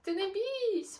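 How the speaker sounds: high, loud coaxing shout; encouraging call. Acted. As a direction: call him down, don't be scared. Kangaroos call Kevin down.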